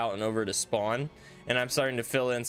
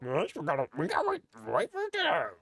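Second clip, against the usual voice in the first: high, fast strained cartoon voice; Big Mouth cartoon voice; cartoon character voice. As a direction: push a silly squeaky voice. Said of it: "why do I sound like a character from Big Mouth".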